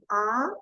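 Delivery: A said with jaw dropped, mouth wide open; open vowel A. Shown as a demonstration. Teacher demonstrating A with the jaw dropped. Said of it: "Usted coloca la mano acá y dice".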